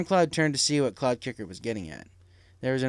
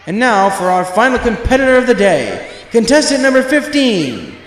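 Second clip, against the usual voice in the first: stadium announcer; 6 semitones higher, 10 dB louder than usual. A narrator's reading. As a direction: boom it out over the amplifier like a showman. Announcer calls final competitor.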